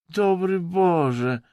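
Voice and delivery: very slow, high and loud wail; the Russian howling in despair, drawn out and high; howled. A narrator's reading. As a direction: wail it to the sky. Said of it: "zawył".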